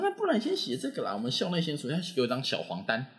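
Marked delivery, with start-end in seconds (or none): 0.0-1.9 much higher coaxing voice; imitating nurse. School nurse offering the lesser form, high coaxing imitation. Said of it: "我说".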